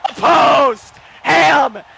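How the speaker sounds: screaming the goal call; excited goal call. Real, not acted. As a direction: yell it like the puck just went in. Penalty shot goal call.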